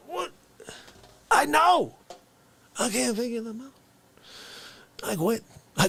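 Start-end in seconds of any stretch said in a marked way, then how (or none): none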